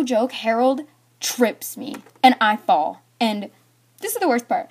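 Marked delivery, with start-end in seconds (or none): none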